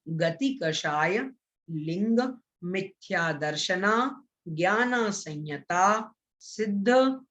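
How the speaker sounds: very slow, word-by-word chanting with long pauses; broken-up recitation. Shown as a demonstration. Teacher demonstrating a sutra's correct pronunciation to students, broken up slowly. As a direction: break the verse apart piece by piece. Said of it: "तोड़ तोड़ करके, अलग अलग करके".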